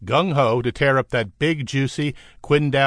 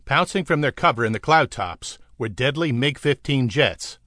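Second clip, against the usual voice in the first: ordinary delivery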